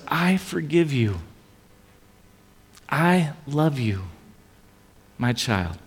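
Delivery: slow, soft and tender, a father forgiving; tender voice of God. Acted. Preacher voicing God to each listener, slow and tender.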